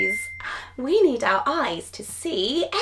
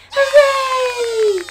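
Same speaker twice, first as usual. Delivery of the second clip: loud happy hooray; excited cheer; 6 semitones higher, 5 dB louder than usual. Acted. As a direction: cheer it out big. Cheering at toy time.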